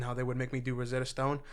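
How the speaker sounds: ordinary talk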